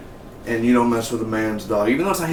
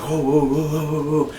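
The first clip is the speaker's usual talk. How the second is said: higher run of whoas, imitating a startled crowd; mock crowd alarm; 5 semitones higher than usual. Everyone at the funeral reacting, mock alarm. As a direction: wobble through a string of mock-alarmed whoas.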